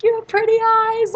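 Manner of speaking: high, loud fangirl yell; calling out flirtatiously. Retold or imitated. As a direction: shout the compliment at the top of the voice. Calling out to blushing server.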